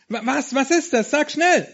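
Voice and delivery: nervous lamb begging her friend, high and loud; anxious urgency. Acted. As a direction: beg him to say it quickly. Anxious lamb urges Sammy.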